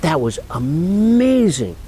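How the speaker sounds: thrilled coordinator raving; over-the-top thankful; gushing praise. Retold or imitated. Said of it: "she says".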